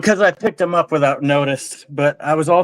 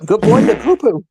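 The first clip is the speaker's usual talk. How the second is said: calling a dog; 5 semitones higher than usual. Real, not acted. Praising the dog.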